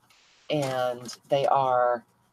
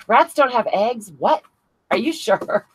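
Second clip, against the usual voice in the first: higher, louder, slow disbelieving question; real surprise; 7 semitones higher, 4 dB louder than usual. Real, not acted. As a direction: react in genuine disbelief. Reacting to chat comment.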